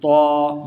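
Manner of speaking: تو said heavy and full-mouthed; long, rounded, heavy تو; thick letter. Shown as a demonstration. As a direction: thicken and hold تو. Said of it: "موٹا".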